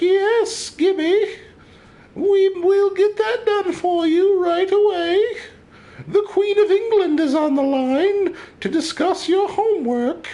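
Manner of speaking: very high, slow falsetto of a posh mother; mock mother voice. Mocking his friend's rich-kid upbringing in a high put-on mother voice. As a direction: squeak it out like a fussy society mom. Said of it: "my mom sounds nothing like that".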